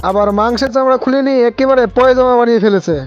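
usual speaking voice

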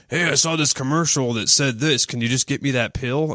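a hint of a put-on patient voice; imitated patient. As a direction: play a pushy patient asking the doctor. Acts out patient demanding pill.

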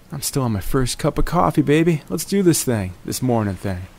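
ordinary talk, nothing marked

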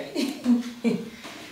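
high airy giggle; a bright breathy laugh. Real, not acted. Laughing as she hands over the turn, giggle.

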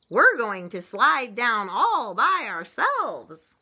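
slow, loud, high announcement; calling out. A narrator's reading. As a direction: call it out proudly to mother, word by word. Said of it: "her little chickens calling".

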